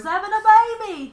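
high, loud mimicked cry; mock labour wail. Podcast host mimicking Claire in labour, mock wailing. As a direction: wail it out like a hammed-up birth scene.

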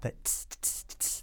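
unvoiced tss hi-hat clicks; soft vocal hi-hat; hi-hat sound. Shown as a demonstration. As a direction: breathe out a clean hi-hat. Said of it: "that clean hi-hat".

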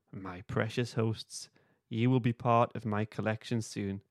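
usual speaking voice

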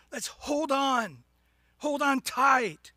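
slow, loud, drawn-out urging; emphatic exhortation. Real, not acted. Preacher urges congregation to hold fast, loud and slow. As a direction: urge them on, leaning hard on each word.

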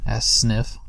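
ordinary delivery

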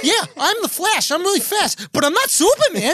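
Boston accent, hammed-up whine; Boston Flash.

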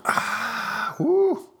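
whoo after a shot of tequila; after a strong sip. Real, not acted. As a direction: gasp at the burn.

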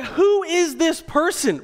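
high, slow, incredulous; stunned disbelief. Retold or imitated. Husband amazed his wife wants to swim back in, incredulous. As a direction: ask it in total disbelief.

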